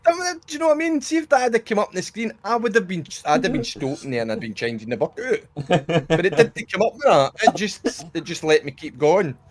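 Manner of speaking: real excited laughing rant, high. Real, not acted. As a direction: wound up and giggling while he explains. Laughing rant about the game.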